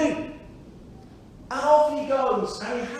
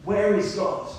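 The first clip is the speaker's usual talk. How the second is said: ordinary talk, nothing marked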